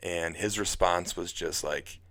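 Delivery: normal talking voice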